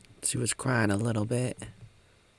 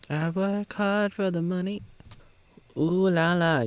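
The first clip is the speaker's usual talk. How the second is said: high mock voice; 10 semitones higher than usual. Mock voiced woman's line.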